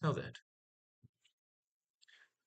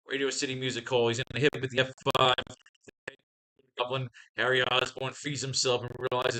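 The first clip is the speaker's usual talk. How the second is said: ordinary delivery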